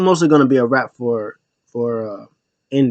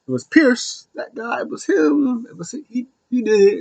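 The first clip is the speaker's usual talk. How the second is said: higher, softer voice, pinning the blame on Pierce; snitching Nick Aldis; 9 semitones higher, 4 dB quieter than usual. Retold or imitated. Aldis ratting out Pierce backstage, sheepish and high. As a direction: point the finger sheepishly.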